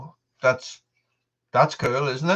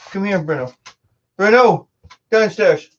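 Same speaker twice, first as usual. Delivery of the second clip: sharp, louder order to the dog; firm command; 6 semitones higher, 4 dB louder than usual. Real, not acted. Owner sends dog Bruno downstairs, firm loud command. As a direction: send the dog down firmly.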